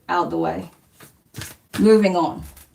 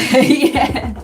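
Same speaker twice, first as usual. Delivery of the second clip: higher, louder laughing yeah; laughing agreement; 6 semitones higher, 5 dB louder than usual. Real, not acted. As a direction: agree through a burst of laughter. Amused agreement with viewer comment.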